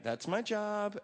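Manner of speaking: a hint of the wife's hurt protest. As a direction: play the wife, a little put out. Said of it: "So Kathy was like".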